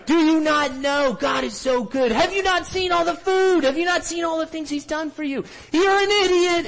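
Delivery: very high, loud mock scolding; imitated outraged yelling. Villagers yelling at Eve during the skit, imitated outraged yell. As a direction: yell it shrieking high, like a heckler at a play. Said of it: "they're yelling at her".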